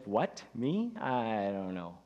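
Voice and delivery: taken aback, then a low drawn-out waver; reluctant volunteer. Retold or imitated. Pastor retelling how an introvert reacted to being asked to lead, mock reluctance. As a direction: mimic someone balking at being asked. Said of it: "and he's like".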